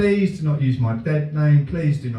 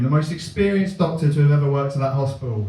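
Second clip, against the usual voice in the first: ordinary talk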